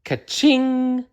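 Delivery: high, bright ringing ka-ching; cash register ka-ching. A narrator's reading. Cash register ringing out in the story. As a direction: ring it out like a till. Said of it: "went her cash register".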